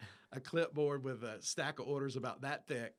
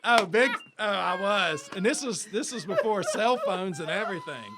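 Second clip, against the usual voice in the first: big laugh through the words, higher and louder; 7 semitones higher, 15 dB louder than usual. Real, not acted. Laughing to his friend about coming back late, loud and high. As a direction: laugh it out, almost shouting.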